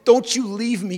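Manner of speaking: loud pleading prayer; desperate cry to be renewed. Acted. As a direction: beg God with urgency. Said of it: "He's crying out".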